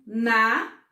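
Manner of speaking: "Na" loud and standing alone; a firm, separate "Na" for pupils to count; isolated syllable. Shown as a demonstration. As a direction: punch out "Na" as one counted beat. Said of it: "falar a palavra pra vocês e contar".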